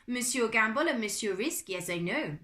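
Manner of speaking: put-on formal French-accented butler. A narrator's reading. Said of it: "replied in a French accent".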